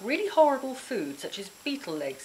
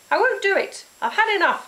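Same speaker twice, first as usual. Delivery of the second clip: high, loud furious shout; 14 semitones higher, 8 dB louder than usual. Acted. Girl refusing Grizz, high furious shout. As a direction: scream your refusal. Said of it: "I shouted".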